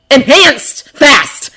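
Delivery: slow, loud, high punch on each word; alarmed emphasis. A narrator's reading. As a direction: hit both words hard in alarm. Narrator dramatizes sudden alarm.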